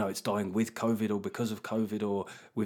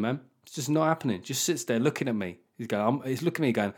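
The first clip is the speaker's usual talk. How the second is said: normal talking voice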